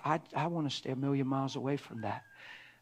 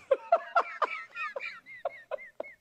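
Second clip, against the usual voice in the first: high delighted laugh. Real, not acted. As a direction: burst out laughing at yourself. Laughing at own joke.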